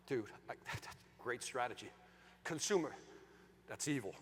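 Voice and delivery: low, soft musing; half admire it, half condemn it under your breath; torn. Retold or imitated. Sales trainer torn over a rival's strategy, quiet musing. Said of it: "I'm torn".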